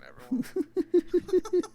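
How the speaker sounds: word stretched into a high laughing squeal. Real, not acted. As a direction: dissolve into giggles mid-word. Voice cracks into squeal.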